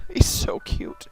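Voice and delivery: ordinary talk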